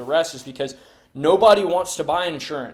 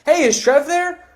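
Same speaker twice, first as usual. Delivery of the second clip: high, louder and slow, like you know him; friendly familiar; 12 semitones higher, 7 dB louder, slower than usual. Shown as a demonstration. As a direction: sound like an old friend calling. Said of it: "I sound like I know the guy".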